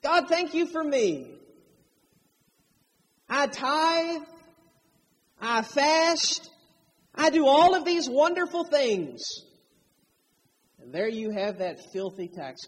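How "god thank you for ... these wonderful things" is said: higher, preening self-praise; smug Pharisee prayer. Retold or imitated. Preacher voicing the proud Pharisee, smug and raised. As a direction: list your virtues to God, pleased with yourself. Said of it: "the Pharisee prayed within himself".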